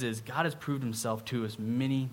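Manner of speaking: normal talking voice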